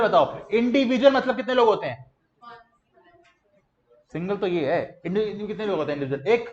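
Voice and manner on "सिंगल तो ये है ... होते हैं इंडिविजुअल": ordinary talk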